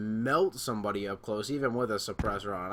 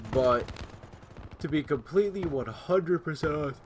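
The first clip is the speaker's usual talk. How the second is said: raised voice; 4 semitones higher than usual. Real, not acted. Streamer explaining to viewers late at night, mildly yelling. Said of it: "I don't know why I'm yelling so much".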